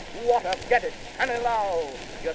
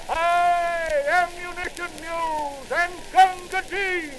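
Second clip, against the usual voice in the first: higher, louder battlefield call; front file shout; 8 semitones higher, 5 dB louder, slower than usual. Acted. Soldiers out of cartridges shouting for ammunition, loud call. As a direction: yell it down the firing line. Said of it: "hear the front file shout".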